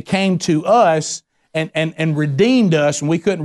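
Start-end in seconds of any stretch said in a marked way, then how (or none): none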